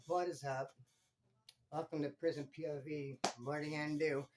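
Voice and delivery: low, soft, deflated greeting; depressed intro. Shown as a demonstration. Vlogger showing his intro when depressed, low and soft. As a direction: say it like you can barely care. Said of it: "in a saddened state".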